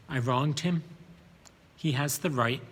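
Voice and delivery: ordinary delivery, nothing marked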